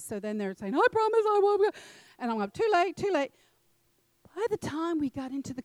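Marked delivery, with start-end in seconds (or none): 0.7-1.7 high, loud mock child's plea; pleading children. Children begging their mother on the way out, high mock pleading. 2.5-3.3 high-pitched, exasperated mother's refusal; mock stern refusal. Mother refusing her children's pleas, mock exasperated.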